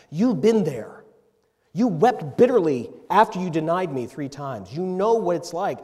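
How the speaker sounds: voicing Jesus urging Peter not to give up, loud and earnest; impassioned plea. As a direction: plead with him, building in force. Jesus voiced with rising force.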